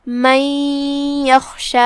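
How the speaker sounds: من یخشی with n merged into a held nasal y; idgham with ghunnah. Shown as a demonstration. Teacher shows the merging rule, long nasal glide in من یخشی. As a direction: hum the merged sound between من and یخشی. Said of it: "غنہ کے ساتھ اور تشدید کے ساتھ".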